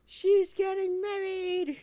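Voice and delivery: teasing singsong. Acted. Teasing announcement, high and slow.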